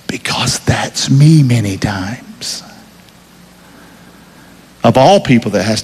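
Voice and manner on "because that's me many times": hushed unvoiced confession; whisper. Real, not acted. Preacher confessing to congregation in a whisper. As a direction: let it slip out in a whisper. Preacher confides a personal admission.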